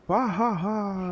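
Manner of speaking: slow, high evil laugh; evil chuckle. Retold or imitated. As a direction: cackle like a cartoon villain. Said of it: "classic villain laugh".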